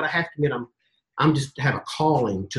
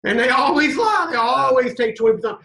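knowing high retort; 9 semitones higher, 3 dB louder than usual. Retold or imitated. Lee knowingly correcting Billy.

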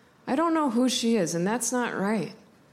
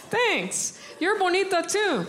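much higher, playful thanks; bright reply; 8 semitones higher, slower than usual. Real, not acted. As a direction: chirp it back to her. Answering grandma back brightly.

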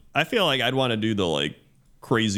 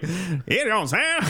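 twangy put-on voice, higher; twang; 8 semitones higher than usual. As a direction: go twangy and nasal. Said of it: "you do the twangy voice".

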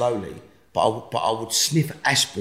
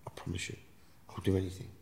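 much softer whispered vow; vowing quietly to the Lord; hushed solemn promise; 3 semitones lower, 14 dB quieter than usual. Retold or imitated. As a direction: promise humbly and sincerely. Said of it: "I said".

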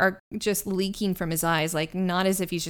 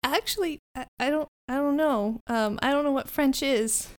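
put-on higher-pitched, hesitant kid; puzzled Fleance; 6 semitones higher than usual. Fleance answering whether his name is French, higher and puzzled. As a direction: stumble innocently in a higher voice.

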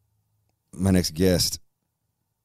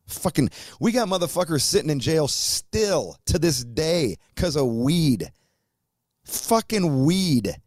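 outraged rant; 10 semitones higher than usual. Real, not acted. Host ranting about people jailed for weed, high and slow with outrage. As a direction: pitch climbing high, words dragged out slow.

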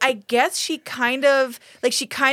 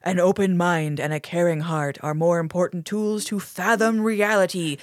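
low, slow creepy TV narrator; creepy Outer Limits voiceover; 4 semitones lower than usual. Retold or imitated. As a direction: intone it like an eerie sci-fi voiceover, dropping low and dragging the end. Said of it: "the creepy Outer Limits voiceover going".

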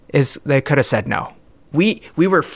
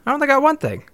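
indignant; 12 semitones higher, faster than usual. Acted. Protests other gets two things.

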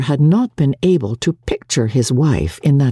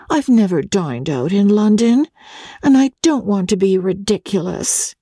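put-on a touch of a sulky young wife's raised voice; May, pouting; 6 semitones higher than usual. Pouting young wife confides her fear of London dinners. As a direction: pout it out, lightly.

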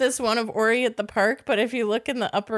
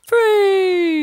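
excited shout; 10 semitones higher, 8 dB louder than usual. Pretend. Dramatic exclamation ending host's plug.

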